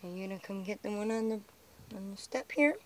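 ordinary delivery

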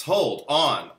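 ordinary talk

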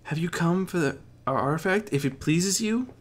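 high, timid servant voice; bow and scrape as you ask; a servant offering meekly. A narrator's reading. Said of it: "offered meekly".

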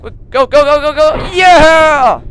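high loud panicked yelling; frantic yells while fleeing; yelling while running away. Real, not acted.

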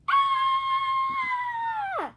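long, high, loud shriek; acted scream of sudden fright; startled scream. Piercing drawn-out "aah".

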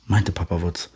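usual speaking voice

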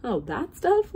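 high, loud, amused dismissal; laughed. A narrator's reading. Captain dismisses the oobleck, laughingly. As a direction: scoff it with amusement. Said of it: "laughed the captain".

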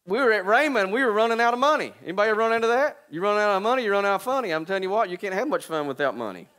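loud, high preacher's cry; preaching at full force. Acted. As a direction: preach it out to the crowd.

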